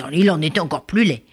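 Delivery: plain reading voice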